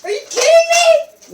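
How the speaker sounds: high, loud outraged yell; outraged shout. Real, not acted. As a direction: shout it in disbelief. Disbelief at being shot.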